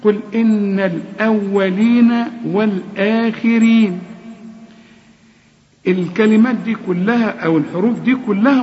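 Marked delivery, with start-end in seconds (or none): 0.0-4.0 قل إن الأولين with a held nasal nun; slow recited verse; nasal ghunna. Shown as a demonstration. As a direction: hum the nun in إن. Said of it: "لازم تغني".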